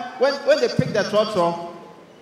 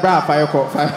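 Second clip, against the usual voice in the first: germ voice; 8 semitones lower than usual. Retold or imitated. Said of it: "they're like".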